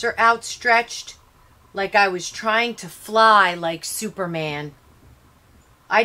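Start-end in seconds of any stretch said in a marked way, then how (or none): none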